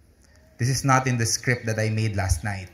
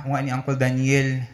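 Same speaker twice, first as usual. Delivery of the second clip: ordinary talk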